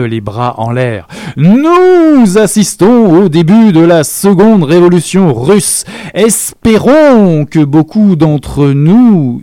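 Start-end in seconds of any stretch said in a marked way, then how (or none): 1.4-5.8 higher, louder grand announcement, arms raised; lofty, staged oratory; theatrical proclamation. A narrator's reading. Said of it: "leva de façon théâtrale". 6.1-9.4 louder, slow, pointed proclamation; grand, slow Espérons, higher and louder; theatrical. A narrator's reading. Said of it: "leva de façon théâtrale".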